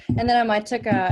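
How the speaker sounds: ordinary talk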